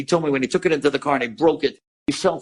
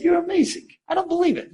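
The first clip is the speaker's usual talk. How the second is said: very high-pitched retold amazement; amazed; 14 semitones higher than usual. Son hearing the stranger will visit his mother, amazed. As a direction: imitate someone marveling, voice way up.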